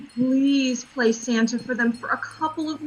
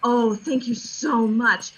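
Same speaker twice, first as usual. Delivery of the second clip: ordinary delivery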